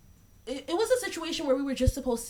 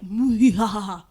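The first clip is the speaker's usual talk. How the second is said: hammed-up, louder evil cackle; mock villain cackle hanging up on the ex; villain laugh; 10 dB louder than usual. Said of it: "hee hee ha ha laugh".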